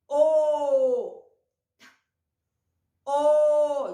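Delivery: O held long and loud; letter sound demo. Shown as a demonstration. Teacher showing the sound of O, sounded out. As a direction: sustain each O on one steady note. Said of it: "o sonzinho delas".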